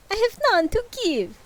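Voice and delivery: high spooky ghost; a proper ghostly reply. Acted. Ghost answers Scrooge in a high spooky voice. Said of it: "There we go".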